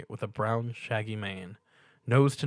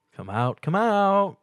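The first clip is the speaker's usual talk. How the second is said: higher, slow teasing singsong; singsong taunt; 10 semitones higher, 7 dB louder, slower than usual. A narrator's reading. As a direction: lure her out teasingly. Stuart teasingly calls Pinky.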